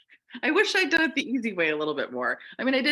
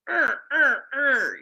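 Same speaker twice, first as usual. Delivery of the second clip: frustrated groaning; 8 semitones higher, 7 dB louder, slower than usual. Retold or imitated. Years of obstacles retold.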